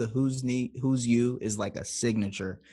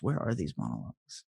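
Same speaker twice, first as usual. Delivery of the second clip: ordinary delivery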